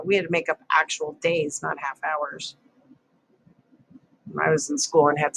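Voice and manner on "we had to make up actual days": usual speaking voice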